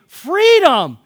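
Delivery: high, loud shout re-enacting a scream; drawn-out, jubilant holler; screamed cry of freedom. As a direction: yell it out to the whole crowd. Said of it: "I just started screaming".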